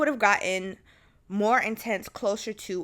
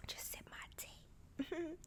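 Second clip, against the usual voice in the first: very soft, slow, whispered aside; hushed aside; 11 semitones higher, 20 dB quieter, slower than usual. Real, not acted. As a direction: murmur it slowly to yourself. Aside while sipping tea.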